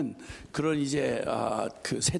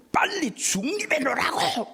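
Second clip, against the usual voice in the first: mock shout, much higher; retold yell; 11 semitones higher than usual. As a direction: bark the order like the angry attendant. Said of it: "막 이렇게 소리를 지르고". (화난 아저씨의 흉내 낸 고함)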